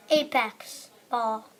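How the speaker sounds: normal talking voice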